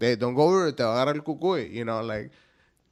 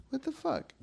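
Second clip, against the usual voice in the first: high mock outrage; 7 dB quieter than usual. Mocking a confused teen.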